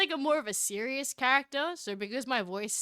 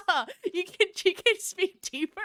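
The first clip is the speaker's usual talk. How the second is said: higher, louder mock director; imitated coaching, pitched up with amusement; 6 semitones higher, 5 dB louder than usual. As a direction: lightly mimic someone giving notes. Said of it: "And the person's like".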